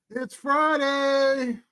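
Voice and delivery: high, slow, stretched-out Friday call; drawn-out excited call. Acted.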